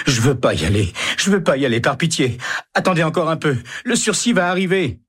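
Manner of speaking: high, desperate begging; pleading in terror. A narrator's reading. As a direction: beg them not to take him. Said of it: "se met à pleurer".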